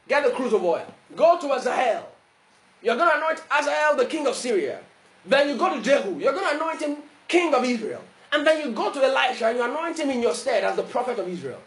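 emphatic pulpit instructions; preaching. Acted. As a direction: issue God's orders with force.

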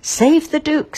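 high, loud urgent command; urgent king's order. A narrator's reading. King commanding his guards, high and urgent. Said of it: "said the king to his guards".

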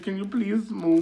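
high, slow, soft mocking whine; mocking request. Castmate quoted asking Garrity to move, in a high slow mock whine.

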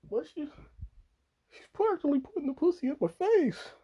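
very high, loud incredulous squeal; hammed-up high-pitched outrage; mock protest. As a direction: protest shrilly to whoever is holding you back. Acted protest to the warning.

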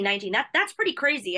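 usual speaking voice

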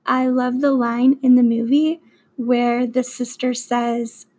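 ordinary delivery

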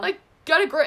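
high and loud, exasperated at herself; retold shout at her crying self; self-scolding shout. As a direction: tell yourself off like a fed-up friend.

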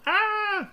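sudden high shrieked ah; imitating a woman's startled scream; mock scream. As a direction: let out a startled, hammed-up shriek. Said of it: "schreeuwt ze van".

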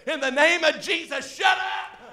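high, loud yell of command; hollered order to break up a fight; retold scream. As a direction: scream it like a comic rebuke.

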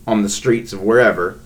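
normal talking voice

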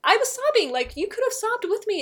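high, loud, exasperated objection; retold pleading protest. Mother retells arguing with daughter.

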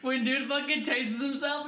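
normal talking voice

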